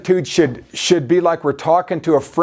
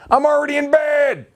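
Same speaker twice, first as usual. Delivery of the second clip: annoyed shout from bed; 8 semitones higher than usual. Acted. Neighbor shouting from his bed at a midnight caller. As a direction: yell it through the door, fed up.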